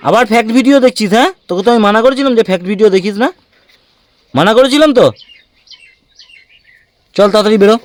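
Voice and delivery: loud, high-pitched parental telling-off; stern scolding. Real, not acted. Parent scolding child over videos. (চড়া গলায় বকুনি)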